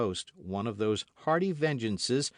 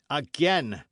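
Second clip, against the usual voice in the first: loud, higher snap; irritated outburst; 7 semitones higher, 9 dB louder than usual. A narrator's reading. D'Artagnan hearing coiners again, irritated outburst. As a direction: flare up. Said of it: "whose ears chafed terribly".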